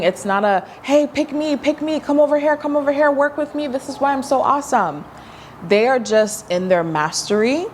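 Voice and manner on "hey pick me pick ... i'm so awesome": higher, pushy pick-me pitch; hammed-up desperate self-promotion; mock needy plea. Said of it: "they're not screaming".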